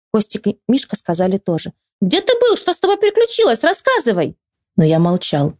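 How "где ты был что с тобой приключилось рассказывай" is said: put-on high-pitched, eager boyish questioning; excited boys. A narrator's reading. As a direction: fire questions in a high kid's voice. Two boys question him excitedly.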